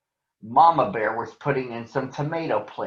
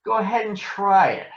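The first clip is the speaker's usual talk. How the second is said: bully's taunt; 8 semitones higher than usual. Acted. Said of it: "said Too Tall".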